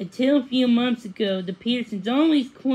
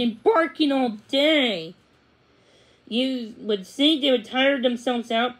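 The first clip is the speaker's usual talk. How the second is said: ordinary delivery, nothing marked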